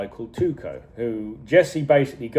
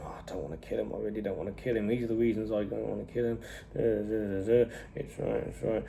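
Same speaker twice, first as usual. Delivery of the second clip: much softer, low mutter, almost whispered at the end; muttering to himself; 3 semitones lower, 11 dB quieter, faster than usual. Retold or imitated. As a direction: mumble the list of reasons under your breath. Walt making notes, reasoning alone.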